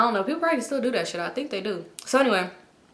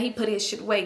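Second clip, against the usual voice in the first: ordinary talk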